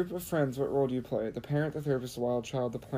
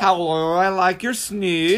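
put-on loud, drawn-out goofy accent; mock Irish-like accent; 3 semitones higher, 6 dB louder, slower than usual. Showing friend the silly dog greeting, mock Irish-like accent. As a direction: stretch it out in a vague fake accent. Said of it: "is that Irish?".

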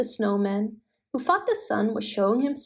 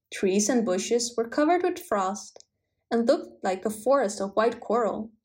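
usual speaking voice

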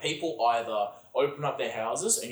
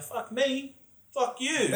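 higher, louder mock comeback, hammed up; retold snap back; 3 dB louder, slower than usual. Retelling his comeback to the kid who swore at him, high and cocky.

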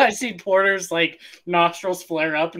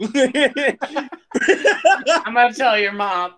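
laughing mock threat; 7 semitones higher, 6 dB louder, slower than usual. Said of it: "mock threat while laughing".

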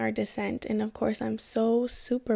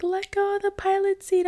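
much higher, tense urgent command; urgent instructor; 10 semitones higher, 3 dB louder than usual. Retold or imitated. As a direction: hurry her out the door. Instructor urging her at open door.

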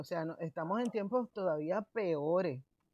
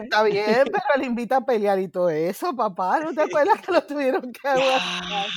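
loud; 4 semitones higher, 10 dB louder than usual. Real, not acted. Heated recollection of brawl.

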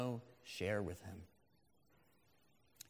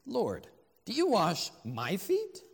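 slow, louder disbelief, pitch jumping about; incredulous question; 3 semitones higher, 5 dB louder, slower than usual. Acted. Peter questions Jesus as he comes to wash his feet, incredulous. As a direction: ask it in slow, astonished disbelief.

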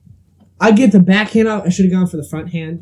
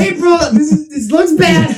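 panicked plea; 11 semitones higher than usual. Retold or imitated. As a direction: shout it to April as if it looks bad for you. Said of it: "April, this was not my fault".

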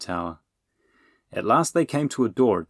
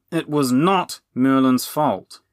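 slow, loud, emphatic denial; higher, indignant defence; insistent; 6 semitones higher, 7 dB louder, slower than usual. A narrator's reading. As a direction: insist on it, word by word. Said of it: "Sourface insisted".

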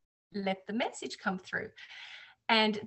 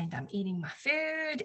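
usual speaking voice

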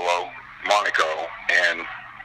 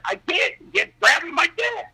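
high, squealed mock complaint; hammed-up panic; panicked protest. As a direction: reenact a flustered plea, pitched way up. Retold protest at being grabbed.

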